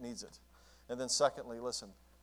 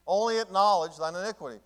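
higher, louder preaching of acknowledge; preached louder; 6 semitones higher, 9 dB louder than usual. Shown as a demonstration. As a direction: drive the verse home with force. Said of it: "repeated louder".

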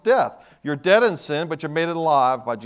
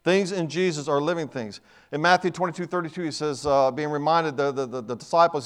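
usual speaking voice

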